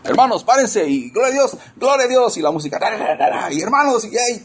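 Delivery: high, hyped mock hype-man calls; cheerleader worship leader. Preacher parodying a cheerleader-style service, high and hyped. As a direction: ham up a pep-rally preacher. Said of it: "como cheerleaders".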